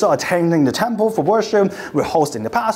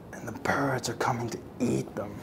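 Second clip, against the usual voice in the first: hushed, breathy whisper; soft eerie whisper; 6 semitones lower, 12 dB quieter than usual. Acted. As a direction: whisper it like a dread secret. Eerie image of the valley.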